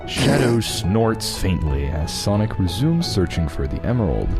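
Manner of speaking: ordinary delivery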